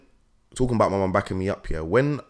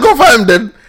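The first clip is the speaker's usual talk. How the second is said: laughing quote; 13 semitones higher, 11 dB louder than usual. Retold or imitated. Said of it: "he said".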